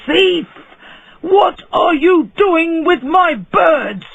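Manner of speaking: high angry yell, slow on the second line; furious shout. A narrator's reading. Bird seller shouting at the dwarf among his birds, furious. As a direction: bellow at the thief. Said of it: "he shouted".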